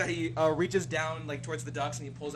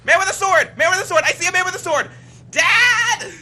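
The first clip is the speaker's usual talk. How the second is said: put-on high, loud panicked child yelling for dad; a scared little kid shrieking at a stranger's sword; frightened Cyclops boy; 14 semitones higher, 12 dB louder than usual. Little Cyclops boy screaming for his dad at the man with a sword. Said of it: "little Cyclops boy looks out and he's like".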